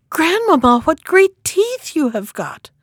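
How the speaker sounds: put-on amazed little girl, voice pitched right up; greatly amazed. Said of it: "being greatly amazed".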